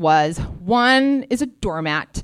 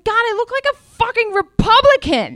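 much higher, louder, indignant cry; outraged exclaim; 13 semitones higher, 4 dB louder than usual. Retold or imitated. Fuming at her mirror image, pitched high and indignant. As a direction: let the disgust fly up high.

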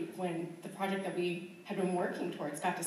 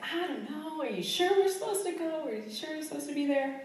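imitating the husband, higher and doubtful; worried husband; 8 semitones higher than usual. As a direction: voice him as an anxious, unsure partner. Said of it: "And he's like".

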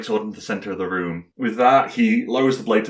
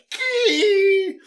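long, very high dragging squeal; mouth-made screech of a dragged sword; imitated metal screech; 3 dB louder than usual. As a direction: draw out a high scrape of metal. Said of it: "you hear the".

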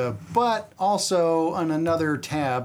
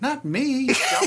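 pitched up high and louder, a mocking jab; mock retort; 11 semitones higher, 3 dB louder, slower than usual. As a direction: snap back at the dumb kid, mockingly. Voicing movie character's retort.

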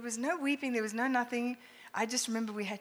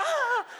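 much higher, louder yelp as people fall; laughing mock yelp; 9 dB louder than usual. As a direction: squeal as if knocked over. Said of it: "And they go".

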